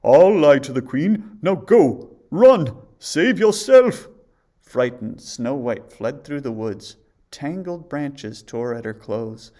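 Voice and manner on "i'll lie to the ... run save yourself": very loud, rising, frantic warning; urgent huntsman. A narrator's reading. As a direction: urge her to flee at once. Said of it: "said the huntsman".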